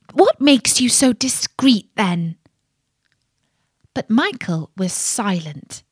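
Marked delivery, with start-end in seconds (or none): 0.1-2.4 louder, harsh princess interrogating; harsh questioning. A narrator's reading. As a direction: demand an explanation, voice raised. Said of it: "said to him in a harsh voice".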